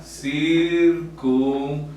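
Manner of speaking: circunflejo stretched out slowly, cir-cun-flejo; syllable by syllable. Shown as a demonstration. Teacher naming the accent for students, circunflejo slow and drawn out. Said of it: "aquí está".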